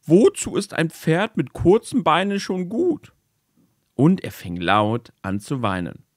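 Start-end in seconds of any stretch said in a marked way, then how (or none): 0.0-3.1 sad horse. Acted. Sad horse laments its legs.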